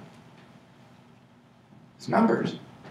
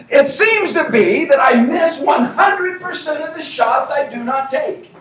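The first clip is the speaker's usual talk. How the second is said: grand declaration; 8 semitones higher, 5 dB louder than usual. Shown as a demonstration. Speaker proclaims the quote to the room, grandly and loudly. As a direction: boom it out like a famous quote. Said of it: "louder mock-grand restatement of the quote".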